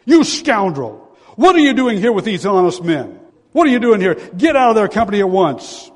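loud, high furious shout; preacher acting the duke's angry outburst. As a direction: roar at the prisoner like an outraged duke. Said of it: "the duke shouted".

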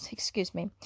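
whispered aside, excuse me; whispered apology. Real, not acted. Apologises mid-reading.

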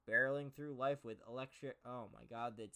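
usual speaking voice

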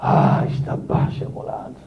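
slow, unvoiced whisper of praise; voicing the self-sacrificer's hushed answer; whispered pious reply. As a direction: breathe it out like a humble devotee. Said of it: "מה הוא אומר?".

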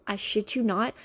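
ordinary delivery

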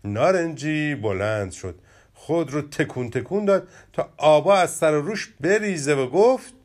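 lively children's story reading, characters lightly voiced; expressive storybook narration with playful character voices. A narrator's reading. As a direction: tell it like a bedtime tale for kids. Reads the story aloud.

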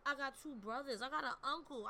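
ordinary delivery

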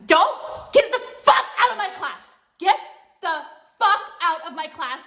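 fed-up teacher yelling at the class; angry outburst. Real, not acted. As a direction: throw them out of the room. Angry rant at commenters.

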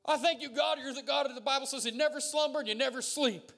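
high, loud preacher's prayer; fervent thanksgiving. Real, not acted. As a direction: cry out thanks to God. Fervent thanks shouted to God.

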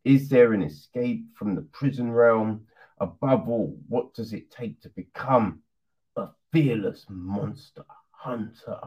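low, dramatic trailer-style read; film blurb read. Acted. Reviewer reading the film's synopsis to viewers, in a low trailer-style voice.

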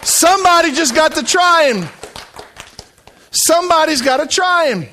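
loud, high pulpit exhortation; impassioned shouted appeal; full-force preaching. Acted. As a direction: call out urgently to the congregation.

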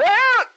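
a sharp high yelp of exasperation; sudden exasperated yell. Retold or imitated. Speaker acting out a frustrated explainer, sharp high yell. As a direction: burst out in a short high cry of frustration. Acted quarrel, explainer snaps.

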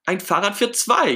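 high, loud burst of delight; delighted Ernie. Acted. Ernie discovering Bert's new tandem, beaming with enthusiasm. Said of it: "Ernie strahlt vor Begeisterung".

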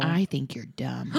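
put-on low, slow, deliberate child's insult. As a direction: imitate a kid solemnly delivering a verdict. Child's insult, retold slowly.

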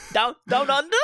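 high-pitched cartoonish Australian accent; mock Australian accent. Joining friends' Hugh Jackman joke, high mock Australian accent. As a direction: squeal it in a silly Australian accent. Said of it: "hay un cabrón que es australiano".

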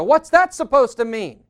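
loud, high, accusing question; mock-offended outburst at a supposed slight; indignant challenge. Husband reacting to wife's fine, loud indignation.

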